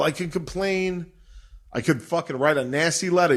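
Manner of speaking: usual speaking voice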